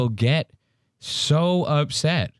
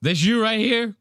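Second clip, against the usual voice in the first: mocking taunt; 8 semitones higher than usual. Mocking taunt quoted to Zach.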